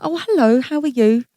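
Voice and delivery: put-on high, cheery hello to an old friend; bright phone greeting. Acts out warm phone greeting.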